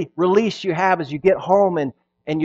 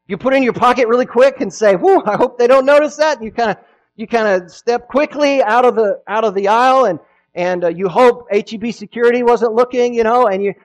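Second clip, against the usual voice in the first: gleeful shopper; 7 semitones higher, 8 dB louder than usual. Retold or imitated. Pastor acts out a sneaky shopper.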